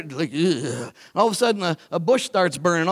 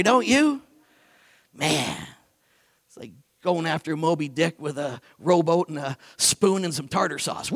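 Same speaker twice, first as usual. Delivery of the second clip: ordinary talk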